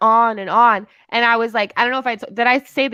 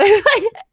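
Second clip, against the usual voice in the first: high laugh; 12 semitones higher, 5 dB louder than usual. Real, not acted. Laughing at her past self.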